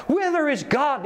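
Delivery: high, ringing cry; madman crying out. A narrator's reading. Madman confronting the crowd, crying out. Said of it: "he cried".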